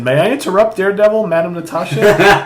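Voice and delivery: high, loud, formal interruption; imitating a stately character making an entrance; Moondragon. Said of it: "And then Moondragon walks in".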